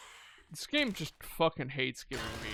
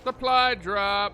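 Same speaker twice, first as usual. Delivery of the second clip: higher, louder, slow announcement; excited call-out; 5 semitones higher, 9 dB louder, slower than usual. Real, not acted. Player spotting a supply drop, loud slow excited call-out.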